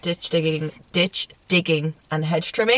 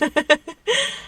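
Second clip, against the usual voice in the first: high laugh; 14 semitones higher than usual. Real, not acted. Laugh at farmer joke.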